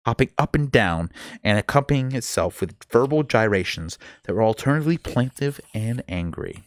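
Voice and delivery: ordinary delivery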